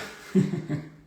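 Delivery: a quick breathy laugh; short laugh. Real, not acted. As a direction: laugh lightly at the comment. Reacting to a comment. (risada curta)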